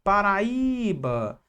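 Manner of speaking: Paraíba with a long held Í; stress on the Í. Shown as a demonstration. Teacher showing the strongest syllable, Paraíba with drawn-out Í. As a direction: stretch out the Í in Paraíba very slowly. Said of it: "Qual é a sílaba mais forte".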